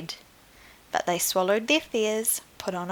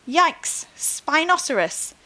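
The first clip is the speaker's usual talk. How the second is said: mock alarm; 8 semitones higher than usual. Storekeeper startled by a dinosaur.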